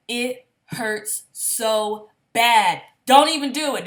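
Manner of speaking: slow, loud, pained complaint; exaggerated pain. Real, not acted. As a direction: drag out the hurt.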